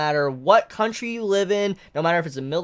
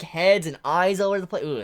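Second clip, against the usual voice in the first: ordinary talk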